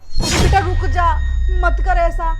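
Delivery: loud, anxious plea to stop; pleading loudly. Acted. Mother loudly begs her daughter not to call.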